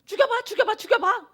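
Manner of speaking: high, loud taunting dare; taunting little sister. Retold or imitated. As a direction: bait her with a shrill-free high loud taunt. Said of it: "막 이러는 거예요".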